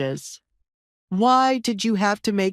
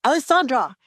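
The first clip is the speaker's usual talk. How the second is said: higher-pitched sharp call; 7 semitones higher than usual. Acted. Father to his fleeing daughter, calling out. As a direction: call after your fleeing daughter by name.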